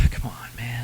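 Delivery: sighing, soft disappointed plea; quiet sighing complaint. Real, not acted. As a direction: let the breath out in dismay. Sighing at cancelled game.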